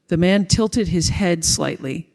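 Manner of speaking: ordinary delivery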